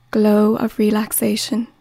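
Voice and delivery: ordinary delivery, nothing marked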